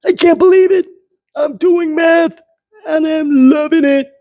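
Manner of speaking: put-on very high, excited little boy; very high thrilled child voice; delighted kid Tom. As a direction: squeal it like a kid amazed he loves math.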